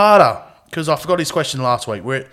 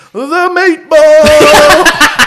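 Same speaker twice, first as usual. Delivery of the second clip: long, drawn-out laughing yell, much higher and louder; head-back yell; 11 semitones higher, 10 dB louder, much slower than usual. Retold or imitated. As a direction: bellow it out across the toilets. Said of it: "I tilt my head back and I go".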